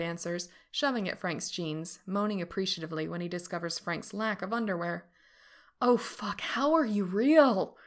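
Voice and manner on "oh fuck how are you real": aroused exclamation. Acted. Overwhelmed lover exclaims.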